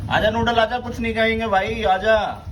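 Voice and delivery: high, gentle coaxing call; coaxing the dog. Real, not acted. Owner coaxes his frightened dog Noodle out from under the bed, high and gentle. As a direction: sweet-talk a frightened pet out.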